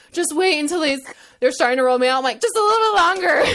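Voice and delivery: high, loud pleading to hold on; desperate pleading. Retold or imitated. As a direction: beg mom not to leave, rising. Begging mom to stay.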